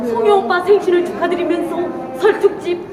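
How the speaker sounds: high, loud emcee shout with laughs; big showy announcement. Acted. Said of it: "자, 간다".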